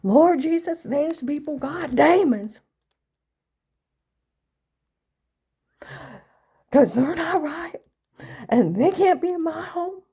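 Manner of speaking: loud, high hammed-up church lady tirade; imitating mom screaming. Mother refusing to be shushed, loud high mock tirade. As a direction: rant like a Southern Baptist mother. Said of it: "she would have said".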